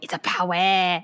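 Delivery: "power" said as "pah-weh" like Waldrick; a light take on Waldrick's way of saying "power"; Waldrick's accent. Retold or imitated. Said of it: "That's, like, how Waldrick says it".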